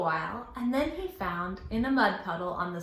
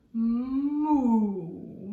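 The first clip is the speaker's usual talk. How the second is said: cow moo; 2 semitones higher, 5 dB louder than usual. Acted. Cow moos in story.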